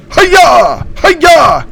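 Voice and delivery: high shouted hi-yah; hero's high battle shout; battle cry. Acted. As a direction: yell it like a cartoon hero charging. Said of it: "that's my impression".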